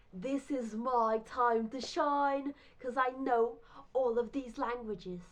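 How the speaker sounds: loud, drawn-out showing off; triumphant boast. Retold or imitated. Teller gets to show off languages, triumphant. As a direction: announce your big moment, loudly stretched.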